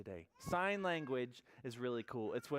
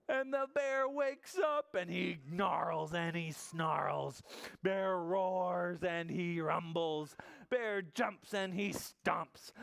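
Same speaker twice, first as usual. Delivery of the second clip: slow, punchy, higher read-aloud; dramatic storytelling; 5 semitones higher, 4 dB louder, much slower than usual. A narrator's reading. Storyteller as the bear wakes furious, slow and punchy. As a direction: hit each angry verb hard.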